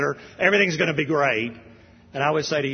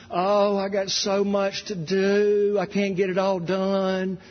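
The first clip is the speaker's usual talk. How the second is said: lightly higher, slow, fussy complaining voice; mocking impression of the pastor; 4 semitones higher, slower than usual. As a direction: moan slowly about having too much to do, half-mocking. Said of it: "he was doing all these impressions".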